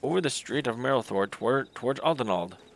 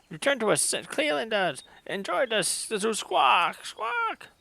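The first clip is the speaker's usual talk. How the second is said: much higher, squawking birdlike voice; squawking bird-man; 10 semitones higher, slower than usual. Acted. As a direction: pitch it way up like an agitated bird creature. Voicing the bird-man Sezu.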